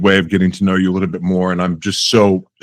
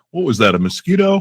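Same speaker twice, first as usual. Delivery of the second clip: higher-pitched, casual puzzled question; lightly puzzled, pitched up; mock puzzlement; 8 semitones higher than usual. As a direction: act out brushing off a tiny nuisance. Retelling own oblivious reaction.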